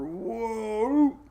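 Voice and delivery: high, rising mock whoa again; whoa sound effect. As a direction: bounce it off the block with a high whoa. Mimicking the storm bouncing off high pressure.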